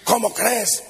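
normal talking voice

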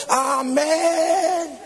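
high, drawn-out mock congregational cry; church's mock shout. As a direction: imitate a whole church calling out. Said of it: "toda la iglesia gritaba".

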